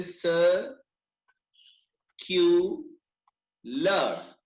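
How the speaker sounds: "सर, Q, लर" in separate syllables, long gaps. Shown as a demonstration. Teacher showing students a longer word, syllable by syllable. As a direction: break "सर Q लर" into three slow, detached beats. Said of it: "more than one syllable word".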